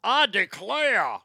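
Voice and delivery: slow, stretched mock-Southern exclamation; exaggerated Southern drawl; fake Southern accent. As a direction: parody a movie Southerner, dragging out the words. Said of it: "fucking phrase that I hate".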